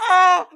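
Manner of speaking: high delighted squeal; excited squeal. Retold or imitated. Mom squealing at daughter's new reading.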